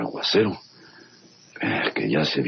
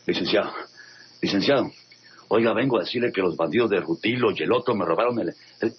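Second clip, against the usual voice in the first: calling out; 6 semitones higher, faster than usual. Acted. Calling out for the lawyer.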